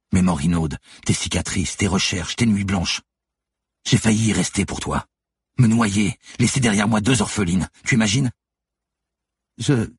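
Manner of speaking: indignant revolt. A narrator's reading. Lucie reminding Manon of three years of hunting, indignant. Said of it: "reprit son souffle avant de continuer".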